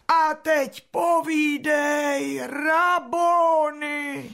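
high, slow, hammed-up adoring voice; mock adoring plea. Martha mimicking Mary begging the master, high mock-adoring voice. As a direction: mimic her sister's gushing plea.

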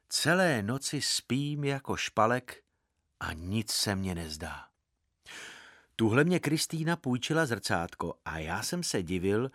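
ordinary delivery

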